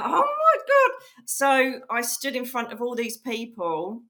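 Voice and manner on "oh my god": high, loud retold "oh my God"; mock panic. Medium told she must go up on stage, acted panic. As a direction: act out alarm in a raised squeal.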